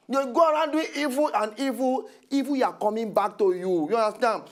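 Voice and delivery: normal talking voice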